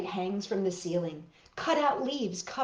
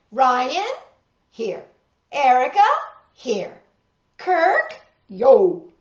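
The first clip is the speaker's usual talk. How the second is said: roll call; 3 semitones higher, 9 dB louder, slower than usual. Acted. Roll call voiced aloud.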